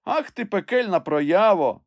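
slow, high, loud scolding cry; relieved shout. A narrator's reading. Master scolds his climber, relieved shout. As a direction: shout an insult with relief behind it. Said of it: "з видимою полегкістю закричав Легран".

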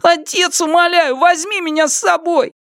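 put-on very high, begging child voice; whining plea. A narrator's reading. Son begging father to take him along, high whining. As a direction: beg and whine high like a little boy. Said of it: "конючил Сыма Лян".

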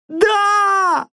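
very high shriek of yes; terrified scream. Acted. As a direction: shriek it in terror. Elf screams confession. (испуганный вопль)

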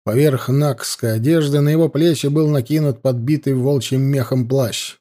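ordinary delivery

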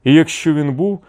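high, puzzled questioning; astonished follow-up. A narrator's reading. Envoys puzzling over the absent chieftain, astonished. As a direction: keep the surprise up high. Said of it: "здивувалися Чадуєві Щоголів".

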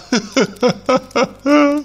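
high sudden wail of sobs; a woman breaking into sobs; sobbing burst. A narrator's reading. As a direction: burst out weeping. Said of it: "сказала она и зарыдала".

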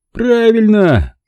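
high, loud, slow triumphant cry; eager exclamation. A narrator's reading. Stanton delighted his guest gets it, triumphant. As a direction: crow it in triumph.

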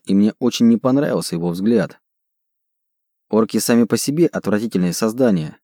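ordinary delivery, nothing marked

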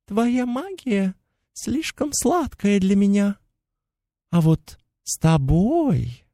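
high, thin mocking fairy voice; chittering pixie. A narrator's reading. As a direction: tease in a tiny insect voice. Pixie creature voice held.

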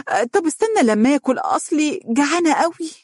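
high-pitched, quick, pleading wife's voice; playful wife. A narrator's reading. Hungry wife making her husband wait, playful and high.

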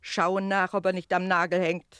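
impatient called reply; called answer. Acted. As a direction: throw the instruction across the room.

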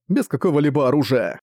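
ordinary delivery, nothing marked

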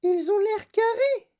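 put-on very high, loud child character voice; Veruca Salt. A narrator's reading. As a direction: protest in a piercingly high voice. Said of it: "dit Veruca Salt". (voix suraiguë de fillette qui proteste)

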